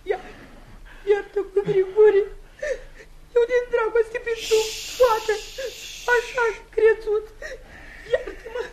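crying, begging forgiveness; tearful pleading. Acted. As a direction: plead through tears. (soția cere iertare plângând)

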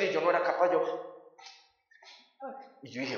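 ordinary delivery

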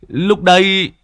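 high goofy embarrassed chuckle; sheepish silly laugh. Acted. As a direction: giggle like a bashful old fool. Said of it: "cười ngờ nghệch".

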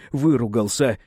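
plain reading voice

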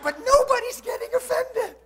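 high, loud imitation of a complainer; mock-exasperated complaint. Preacher imitating a baffled listener in Colorado, exasperated complaint. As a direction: push it high and loud, imitating her disbelief.

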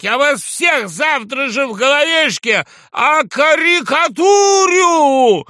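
high, loud, drawn-out bellow; furious shouted threat. A narrator's reading. Drunk journalist threatens everyone as he is thrown out, furious shouted threat. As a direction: shout the threat, stretching the last word. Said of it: "кричал сотрудник".

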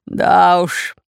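lightly put-on, well-fed bass; bass pirate. A narrator's reading. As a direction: agree in a slight pirate bass. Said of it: "пробасил Бульбуль".

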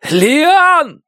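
high, loud anguished scream of a name; wild scream. Acted. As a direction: howl it like a wild animal. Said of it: "крик, похожий на вой дикого зверя".

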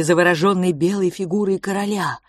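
plain reading voice

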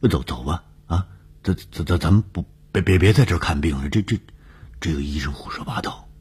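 stumbling, repeating words in a hurry; flustered stammer. Acted. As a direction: stammer nervously as you urge someone to leave. Stunned man urging wife away.

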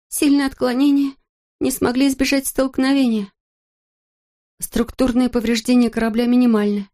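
high, tense injured woman's voice; shaken astronaut. A narrator's reading. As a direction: report through shock and pain. Injured astronaut reports by radio.

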